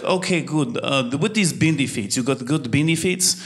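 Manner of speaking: put-on thick comic Russian accent; older Russian woman. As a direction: imitate a Russian barber fishing for details. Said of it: "this older Russian, obviously Russian woman".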